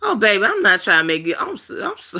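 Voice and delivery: high, fast and choked up, voice cracking; words catching as real tears start; breaking into tears. Real, not acted. To her live viewers, suddenly overcome and crying. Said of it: "I did not mean to just bust out crying".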